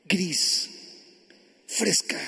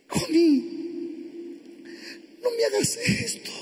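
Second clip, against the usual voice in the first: high, pained cry to a wife; anguished plea; 10 semitones higher than usual. Acted. Husband just abandoned by his wife, anguished. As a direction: plead in a thin, high voice.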